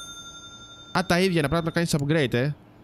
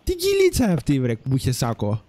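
mock surprise; 2 semitones lower than usual. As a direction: yelp at the odd character in a squeaky voice. Reacting to a game character. (ψεύτικη έκπληξη με τσιριχτή φωνή)

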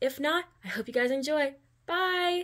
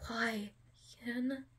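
shaky voice; 6 dB quieter than usual. A narrator's reading. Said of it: "said in a very shaky voice".

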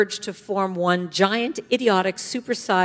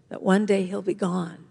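usual speaking voice

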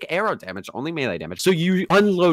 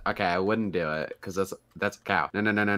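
ordinary talk, nothing marked

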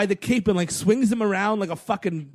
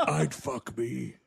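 softer, lower, slow creepy ask; softer low slow hammed-up creep; low slow mock Batman; 7 semitones lower, 7 dB quieter, much slower than usual. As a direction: murmur it low and slow, hamming it. Acting Batman, laughing.

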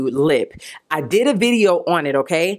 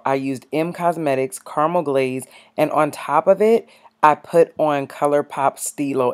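ordinary talk

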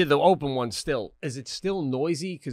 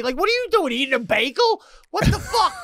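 mock outrage; 12 semitones higher, 4 dB louder than usual. Ranting at ignoring technician.

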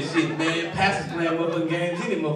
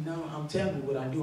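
normal talking voice

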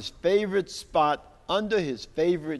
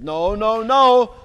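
louder, firm teacher scolding; the bird teacher laying down the rule; bird declaring; 5 semitones higher, 5 dB louder, slower than usual. Acted. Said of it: "declared the bird".

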